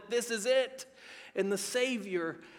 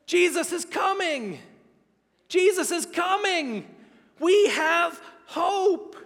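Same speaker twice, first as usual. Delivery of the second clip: joyful proclamation; 7 semitones higher, 4 dB louder, slower than usual. Acted. Zechariah bursting out to the crowd with praise, shouted joyfully. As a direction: shout it out, higher and louder, full of joy. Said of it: "he sings it with everything he has".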